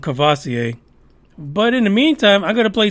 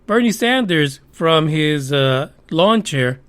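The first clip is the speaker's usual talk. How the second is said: usual speaking voice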